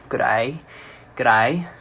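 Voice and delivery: playful Australian greeting; Australian accent. Acted. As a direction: pop out a little Aussie voice. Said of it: "the Australian accent, it just comes out".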